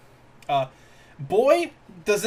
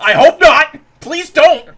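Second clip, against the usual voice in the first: loud, higher pleading shout; 7 semitones higher, 14 dB louder, slower than usual. Real, not acted. Begging Apple to keep kids away, loud shout. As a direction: yell it out, half laughing at the absurdity.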